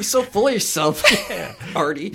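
teasing through laughter; laughing. Acted.

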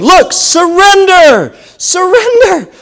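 high, slow plea to surrender; God pleading, stretched and high; God calling. Acted. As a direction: draw the word out high like a gentle divine call. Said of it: "God is calling you to this place of saying".

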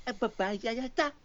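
high, over-stressed nonsense syllables; hammed-up imitation of the game's character gibberish; mock game babble. Showing a friend how game characters sound, high mock babble. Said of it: "sort of emphasizing every".

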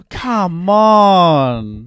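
hammed-up exasperated protest; mock exasperation. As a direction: stretch it out in comic disbelief. Mock exasperation at tacoless restaurants.

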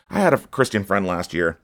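usual speaking voice

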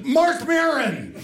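high, slow drawn-out call from a trance; hammed-up entranced woman calling out; trance cry. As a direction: wail the name like a possessed woman.

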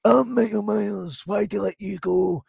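high, slow hammed-up wizard; mock wizard voice. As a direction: play the mad wizard, stretched out.

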